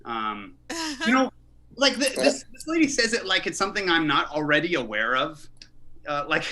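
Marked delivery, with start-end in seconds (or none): none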